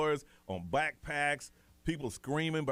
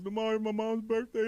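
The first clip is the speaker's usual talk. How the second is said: crying son; 9 semitones higher than usual. Retold or imitated. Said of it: "He goes".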